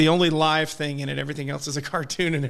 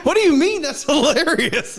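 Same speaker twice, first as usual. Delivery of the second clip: incredulous outburst; 9 semitones higher, 6 dB louder than usual. Retold or imitated. Arguing with wife about the movie.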